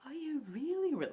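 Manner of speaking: mock the kids' wide-eyed surprise; surprised kids. Kids ask if they're really British, surprised. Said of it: "they're like".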